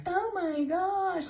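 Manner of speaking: high, slow mock girly gasp; soft falsetto oh my gosh. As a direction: go high and slow like a man faking a woman. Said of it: "his version of a high-pitched voice".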